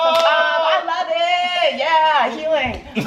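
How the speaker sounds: high, slow sarcastic glee; mock delight. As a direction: pretend to love it, sing-song. Mock cheerful after trauma song.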